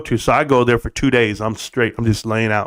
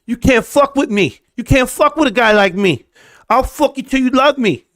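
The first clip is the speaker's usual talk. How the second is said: high cocky trash talk; mock tough boast; 9 semitones higher than usual. Streamer hamming up trash talk to viewers, high cocky boast. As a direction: brag like a cocky hype man.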